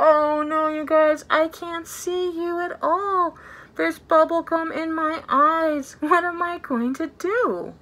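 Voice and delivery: hammed-up loud alarm; mock panic. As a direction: overplay being blinded by gum. Pretend panic, gum in eyes.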